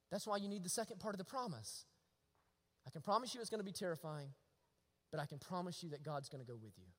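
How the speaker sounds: ordinary talk, nothing marked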